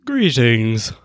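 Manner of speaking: stiff robotic greeting; formal greeting. Pretend. Trying out pickup lines.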